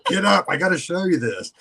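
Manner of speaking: high, loud and laughing; playful excited holler; excited wake-up call. Retold or imitated. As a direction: urgently rouse a sleeper with big news. Acts out waking his girlfriend.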